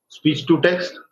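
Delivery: normal talking voice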